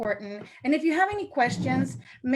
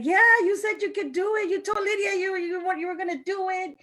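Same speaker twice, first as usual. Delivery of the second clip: insistent upline; 8 semitones higher than usual. Retold or imitated. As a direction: push back, half shouting. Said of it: "She's like".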